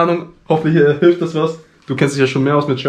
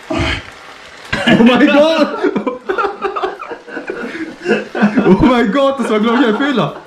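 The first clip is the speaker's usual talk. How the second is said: laughing in shock; 10 semitones higher, much slower than usual. Real, not acted. Reacting to very spicy food.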